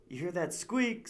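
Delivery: high, slow, loud warning; alarmed. Acted. Narrator warning Jasper of bats, alarmed call. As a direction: point out the danger, startled.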